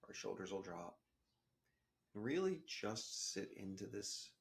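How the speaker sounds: soft, calm meditation guide voice; guided meditation. Acted. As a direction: ease the listener into the breath, gently. Leads a breathing meditation.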